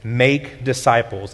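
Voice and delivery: slow, weighty stress on "Make disciples"; emphatic. Shown as a demonstration. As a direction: lean hard on "Make". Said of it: "the most emphatic word that Matthew writes".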